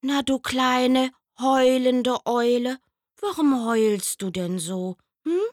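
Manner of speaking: hoch und laut, liebevoll-neckend; Mama Eule. Acted. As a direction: Mutter tröstet ihr Kind. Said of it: "umarmte ihr Kind". (höhere, liebevolle Mamastimme)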